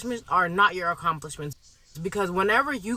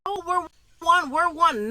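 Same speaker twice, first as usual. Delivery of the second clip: higher, mimicking singsong of "we're one"; mocking quote; 8 semitones higher than usual. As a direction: parrot their line back with scorn. Mocking the other side's slogan.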